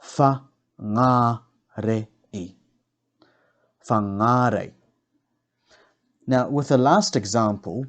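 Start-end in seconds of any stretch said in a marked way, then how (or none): 0.0-2.1 "Whangarei" very slowly split as fa, ngā, re, i; slow teaching breakdown of "Whangarei"; broken into syllables. Shown as a demonstration. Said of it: "break down the words first". 3.9-4.7 "Whangarei" with a long, stressed ngā; stress on second syllable. Shown as a demonstration. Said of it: "I put the emphasis".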